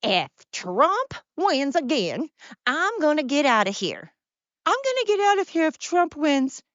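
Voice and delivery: high, put-on whiny protest; mocked celebrity threat. Host mocking Hollywood celebrities threatening to leave, exaggerated high imitation. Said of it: "all the people in America that said".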